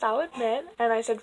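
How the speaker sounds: ordinary talk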